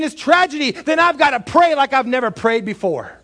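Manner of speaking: loud, high, fervent preaching; shouted pulpit preaching; full-force preaching. Acted. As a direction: preach it out with rising fire.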